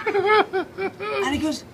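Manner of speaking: stretched out very slowly through giggles; very high, slow laughing lead-in. Real, not acted. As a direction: fight back laughter on every word. Laughing while narrating.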